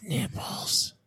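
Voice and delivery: whispering the word; breathy mock-secret whisper. Hushed naughty word.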